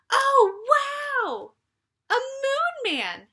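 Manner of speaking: very high, loud, slow wonder; very high, loud thrilled exclamation; amazed cry. Acted. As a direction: gasp it out in awe. Annie sees moon man.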